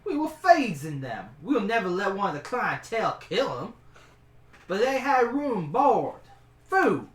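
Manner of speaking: high, loud, stubborn cook. Acted. The cook argues with the adventurer about the rooms, high and insistent.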